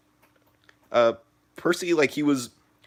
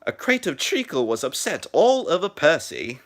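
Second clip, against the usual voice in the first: higher-pitched attempt at a British accent; 5 semitones higher than usual. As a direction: put on your best British accent, pitched up. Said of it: "let me try to ri-, um, mimic [name]'s accent".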